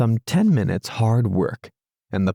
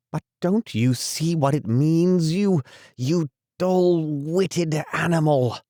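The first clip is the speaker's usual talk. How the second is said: higher, exasperated rat; impatient cry; 8 semitones higher than usual. A narrator's reading. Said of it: "cried the rat impatiently".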